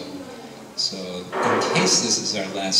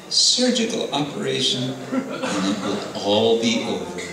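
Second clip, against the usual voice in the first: Nrsingadev amused; 5 semitones higher than usual. Acted. Nrsingadev finishing him.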